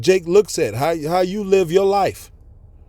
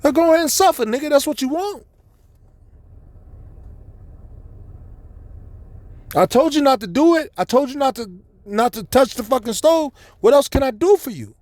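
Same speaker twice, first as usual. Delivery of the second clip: high, sharp I-told-you-so scolding; raised, mock-exasperated giving up; fed-up parent; 7 semitones higher than usual. Parent lets stubborn son suffer, high exasperated scolding.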